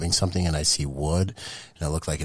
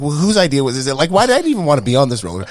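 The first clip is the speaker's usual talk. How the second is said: mock panic; 11 semitones higher, 11 dB louder, faster than usual. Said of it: "And it's like".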